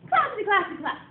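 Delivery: playfully vary the call; teacher's playful call variation. Shown as a demonstration. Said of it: "Let's try that one again".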